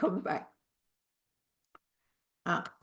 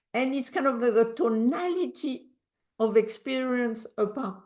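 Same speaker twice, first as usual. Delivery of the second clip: normal talking voice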